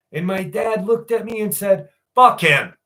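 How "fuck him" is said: loud, blunt retold dismissal; dad's curt dismissal. Dad rejecting his neighbour's good wishes, loudly dismissive. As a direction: imitate a grumpy dad, loud and short.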